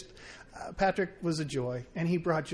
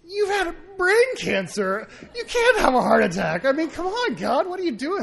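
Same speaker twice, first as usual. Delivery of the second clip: higher, louder mock disbelief; incredulous protest; 10 semitones higher, 8 dB louder than usual. Telling sick Patrick he can't have a heart attack, high mock outrage. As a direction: scold him in hammed-up disbelief.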